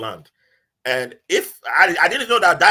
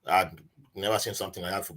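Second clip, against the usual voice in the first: normal talking voice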